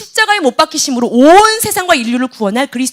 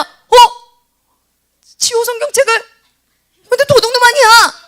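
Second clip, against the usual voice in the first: put-on shocked kid; 9 semitones higher than usual. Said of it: "이렇게 얘기할까?".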